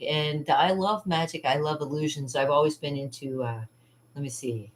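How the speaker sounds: usual speaking voice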